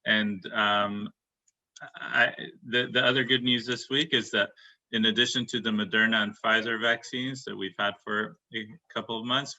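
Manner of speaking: normal talking voice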